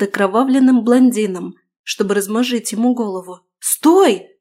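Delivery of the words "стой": high, loud scream to stop; shouting. A narrator's reading. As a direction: yell with all your voice. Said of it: "заорала я так".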